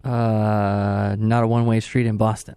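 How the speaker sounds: a hint of a Boston accent; lightly put-on Boston accent. Boston accent while joking with the host. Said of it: "can you tell my accent there".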